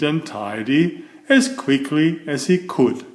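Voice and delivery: lively read-aloud for children, voicing each character; storybook narration. A narrator's reading. Reading a Mr Men book to young viewers, animated storytelling. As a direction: tell it like a bedtime picture book.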